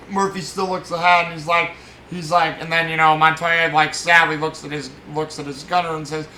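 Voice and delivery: normal talking voice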